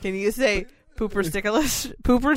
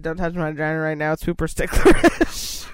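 mock drunk slur; 6 dB louder than usual. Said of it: "that's what I said".

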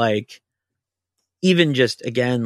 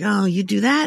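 surprised boss; 10 semitones higher than usual. Retold or imitated. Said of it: "he's like".

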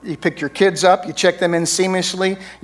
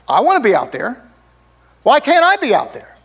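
rising, much higher whine on the question; whiny complaint; 8 semitones higher, 3 dB louder than usual. Retold or imitated. Martha complaining she can't be with Jesus, whining. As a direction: plead like a left-out sister.

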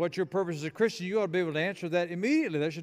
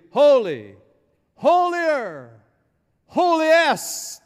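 loud and building, climbing much higher on each word; angels' escalating proclamation; 8 semitones higher, 10 dB louder, much slower than usual. Acted. Preacher voices angels as if in English, rising exultant shout. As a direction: proclaim it big, pitch soaring to the last word. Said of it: "what the angels are saying, if they spoke English".

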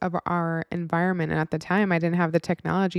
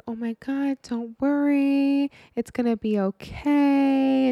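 higher, slow soothing reassurance; mock consoling; 8 semitones higher, slower than usual. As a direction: coo it like calming a crying friend. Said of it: "like, consoling her".

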